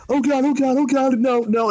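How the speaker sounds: high, frantic "oh God, no"; panicked cry. Retold or imitated. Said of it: "he's like".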